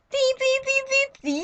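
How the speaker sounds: tiny high-pitched squeals; squeaky squeals. Acted. Friend imitating girl group squeaking, very high squeaks.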